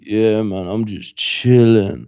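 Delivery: lightly voiced smooth flirt, slow; a hint of a late-night charmer; late-night flirty voice note. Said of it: "they're like".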